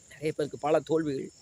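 ordinary talk

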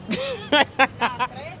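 a short nervous giggle that gives her away; risita nerviosa. Real, not acted. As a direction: giggle as if caught in a lie. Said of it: "¿Y esa risa?". (risita nerviosa al ser pillada)

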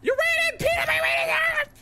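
hammed-up tearful outrage, high; high mock wail. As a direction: blubber the accusation in a squeak. Wailing high voice.

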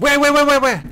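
high, loud rapid-fire repeats; excited urgency. Real, not acted. Asking teammate where the raccoon is, excited urgency. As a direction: fire it out in a high-pitched rush.

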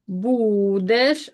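будеш with БУ held long; stress on first syllable. Shown as a demonstration. Teacher marking unstressed vowels for pupils, stressed syllable stretched. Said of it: "е ненаголошений".

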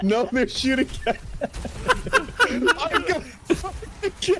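high squealing laughter through words; cracking up mid-sentence; laughing hard. Real, not acted. Laughing at chaos in game.

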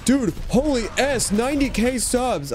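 high, slow mock awe; amazed boast. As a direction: gush like a braggy streamer. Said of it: "he was like".

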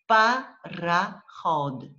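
"пароход" slowly in three beats, "па-ра-ход"; syllable by syllable. Shown as a demonstration. Teacher introduces word to split, syllable by syllable. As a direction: draw out and separate each syllable of "пароход". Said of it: "separate in syllables".

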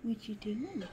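whispered question to the dog. Real, not acted. Cooing at the dog Tinker.

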